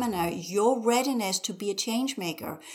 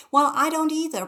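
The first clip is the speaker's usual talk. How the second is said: higher, louder comeback; loud retort; 7 semitones higher, 5 dB louder than usual. Real, not acted. Host answering listeners' money excuse, loud retort. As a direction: snap back at the excuse.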